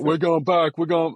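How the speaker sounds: high, loud imitation of alarmed boys; hammed-up cry to turn back; mock panicked protest. Boys panicking on trail run, retold.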